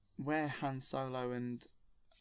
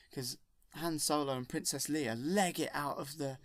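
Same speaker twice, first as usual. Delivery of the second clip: normal talking voice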